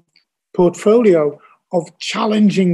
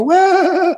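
mocking yelp; 14 semitones higher, 4 dB louder than usual. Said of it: "and they'd go".

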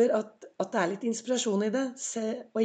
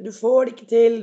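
shouting; 10 dB louder than usual. Retold or imitated. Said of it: "Og de nede roper".

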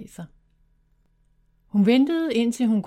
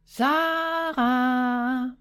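a long drawn-out call, held as if across a forest; shouting; 4 semitones higher than usual. A narrator's reading. Sister calling through the woods for the lost girl, shouting. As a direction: call her name out far and long. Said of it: "der råbte så højt hun kunne".

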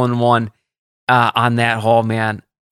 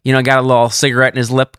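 ordinary talk, nothing marked

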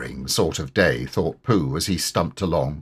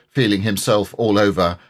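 usual speaking voice

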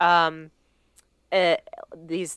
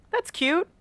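delighted; 10 semitones higher, faster than usual. Real, not acted. Reacting to cute fairy lore.

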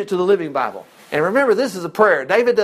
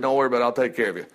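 reassuring God; 8 semitones lower, 5 dB quieter, much faster than usual. Retold or imitated.

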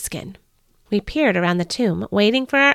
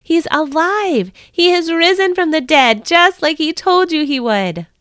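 trumpet-like angel; 7 semitones higher than usual. A narrator's reading. Angel proclaiming Jesus risen to Mary, ringing and triumphant. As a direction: announce the news like a herald's trumpet. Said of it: "spoke in a voice that sounded like a trumpet".

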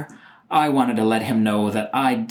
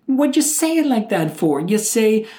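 exasperated scold; 8 semitones higher than usual. Real, not acted. Scolding the cop's phrasing.

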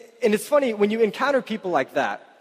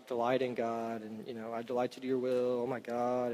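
mock bored mumble; 7 semitones lower, 12 dB quieter than usual. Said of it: "And we're like".

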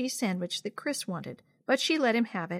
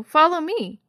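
high, loud order; commanding sister; 7 semitones higher, 8 dB louder than usual. A narrator's reading. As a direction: call the little sister along firmly. Sister orders sibling to march out.

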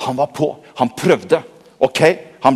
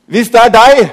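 doubtful Peter; 6 semitones higher, 6 dB louder than usual. Acted. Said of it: "Og så kommer Peter".

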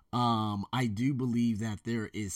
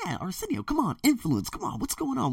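higher, pleading mock viewer voice; viewers' mock plea; 13 semitones higher than usual. Imitating viewers begging him to calm down, higher mock plea. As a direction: plead like a fan scolding him. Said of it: "You guys are probably saying".

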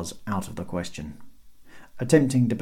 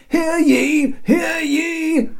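very high, loud crier's call; hailing herald; 7 dB louder, much slower than usual. A narrator's reading. Herald calling up to the city wall, hailing loudly. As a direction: project it up to the ramparts. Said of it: "hailed Cedric".

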